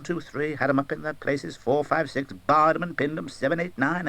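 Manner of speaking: ordinary delivery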